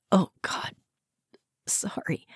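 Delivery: fading to a whisper; embarrassed. A narrator's reading. Wife apologizing after a belch, whispered. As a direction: trail off, ashamed.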